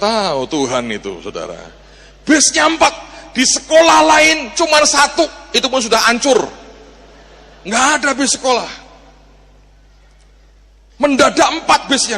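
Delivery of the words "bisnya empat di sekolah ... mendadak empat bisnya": high, loud fervent preaching; fervent exclamation; preaching at full force. Real, not acted. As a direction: drive home the miracle with fire. Preaching climax on four buses.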